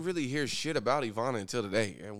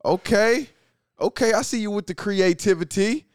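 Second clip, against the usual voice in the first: mock impressed; 9 semitones higher, 7 dB louder than usual. Real, not acted.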